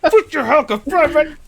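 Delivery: high, loud mock outburst; exaggerated angry yelling; start screaming. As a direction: yell it like a slip into gamer rage. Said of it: "slip up and just start screaming".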